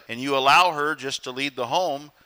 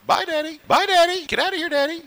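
put-on much higher sing-song child voice; happy little girl; 11 semitones higher than usual. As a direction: chirp goodbye like a cheerful daughter. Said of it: "She's always happy when I go".

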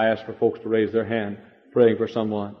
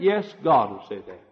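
normal talking voice

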